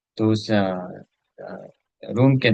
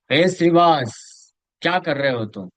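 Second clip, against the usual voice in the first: high, loud, stretched call of a name; shouting call; 6 semitones higher, 4 dB louder than usual. Retold or imitated. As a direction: bellow the name across the house. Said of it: "और जोर से चिल्लाए".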